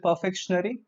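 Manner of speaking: "perfectionary" as per-FEK-shun-ary; mispronunciation. Shown as a demonstration. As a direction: quote the mistaken "perfectionary" with a -shun-ary ending. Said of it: "that's incorrect pronunciation".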